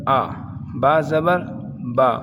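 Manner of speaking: each syllable given alone with pauses, teacher-style; syllable drill. Shown as a demonstration. Teacher drilling students, one syllable at a time. Recites whole alphabet with zabar.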